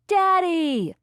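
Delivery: put-on high, loud excited little boy's call; child calling out. A narrator's reading. Little boy greeting his dad on the tractor, calling out. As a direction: call out to Daddy as a small child. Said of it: "Riggins called".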